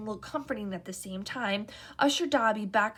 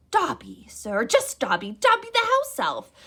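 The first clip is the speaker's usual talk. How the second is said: eager house elf; 5 semitones higher, 9 dB louder than usual. A narrator's reading. House elf meeting Harry, high and eager. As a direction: gush like an awed little elf.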